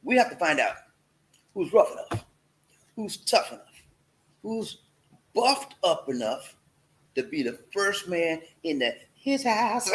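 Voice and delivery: slow, spaced out, each phrase alone. Shown as a demonstration. Host slows the catchphrase down for viewers. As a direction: lay each phrase down slowly. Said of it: "say it slow".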